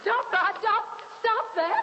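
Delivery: high, loud panicked cries to stop; frantic pleas. Acted. Dina crying out for Roderick to stop. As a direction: beg him to stop, near screaming. Wife pleads to stop staking.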